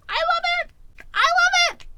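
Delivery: very high, squeaky delight; gushing squeal. Real, not acted. Fan gushing over a vulnerable hero, delighted squeal.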